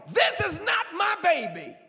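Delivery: high, loud protest; outraged denial. Acted. Queenie waking beside the dead baby, loud denial. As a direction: refuse the dead baby outright.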